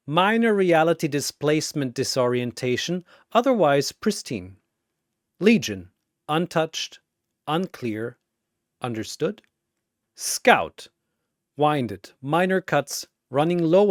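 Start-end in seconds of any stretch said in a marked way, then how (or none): none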